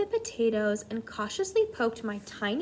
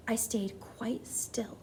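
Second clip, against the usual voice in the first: whisper; 9 dB quieter, slower than usual. A narrator's reading. Mouse hiding, holding still.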